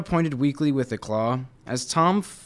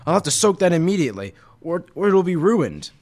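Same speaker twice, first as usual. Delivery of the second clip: fast and louder, flustered; hurried, fretful tiger; flustered rush; 5 semitones higher, 6 dB louder, faster than usual. Acted. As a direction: blurt it out in a panic about the stain. Tiger flustered about stained vest.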